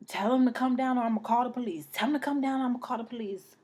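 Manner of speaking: retold yelling. Imitating the gunman yelling threats at her, fast and loud. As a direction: rap out the threat like he did. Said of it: "he kept yelling".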